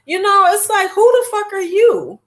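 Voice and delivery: high, incredulous challenge; mock outrage. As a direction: scoff at a self-appointed expert. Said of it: "I be like".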